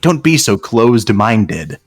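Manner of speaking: usual speaking voice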